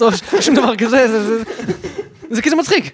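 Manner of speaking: laughing through the words, voice up high. Real, not acted. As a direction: say it while cracking up. Laughing at his own joke. (צוחק תוך כדי דיבור, קול גבוה)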